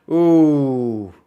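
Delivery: loud, high startled yelp in a razor voice; a raspy razor-voice shout of alarm. Real, not acted. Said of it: "the razor voice there".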